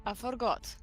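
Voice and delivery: ordinary delivery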